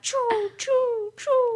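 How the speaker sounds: very high squeaky pew sounds; gun sound effect. Retold or imitated. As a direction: fire off mock blaster noises. Imitating snowball gun sounds.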